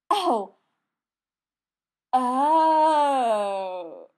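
loud, startled cry of understanding; sudden realisation. A narrator's reading. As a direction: blurt out a loud oh as it dawns. Joe realises the unicorn point.

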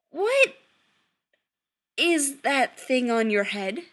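slow, loud disbelief with a long gap; slowly. A narrator's reading. Son staring at his mother's hat, slowly. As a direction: draw it out slowly, pausing after the first word. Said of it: "Chris asks slowly".

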